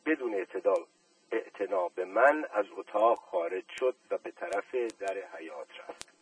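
ordinary delivery, nothing marked

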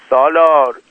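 high, loud call of a name. A narrator's reading. Aqdas on the platform screams for Salar, loud cry. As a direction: call for help across the dark.

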